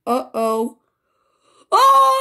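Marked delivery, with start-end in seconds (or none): 1.7-2.2 loud high burst of shock; shocked cry. Acted. Elephant sees she is the duck, loud shocked cry. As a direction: yelp in pure surprise.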